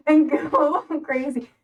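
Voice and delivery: slow, laughing through it; giggling encouragement. Real, not acted. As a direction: let a laugh break the words. Laughing at her own suggestion.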